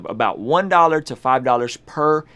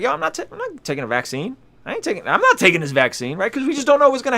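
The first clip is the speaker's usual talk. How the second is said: imitating the friend; 10 semitones higher, faster than usual. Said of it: "he was like".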